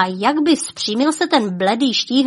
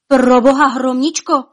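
higher, louder horrified outburst; blurted in horror; 4 semitones higher, 7 dB louder than usual. Acted. King blurting out as his wife pales, horrified. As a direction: burst out in terror. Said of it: "vyhrkl orlík král".

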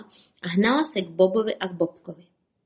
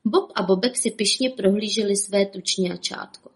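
plain reading voice

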